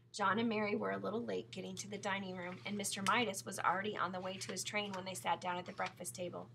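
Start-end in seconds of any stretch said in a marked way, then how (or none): none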